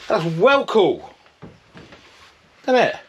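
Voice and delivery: high, loud, slow pleased exclaim; delighted. Real, not acted. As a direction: show off the result proudly. Delighted with result.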